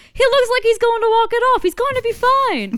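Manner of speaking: high, loud imitation of a movie line; mock hopeful reassurance. Co-host quoting the favorite line, hammed-up reassurance. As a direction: insist it loudly, dragging the ending.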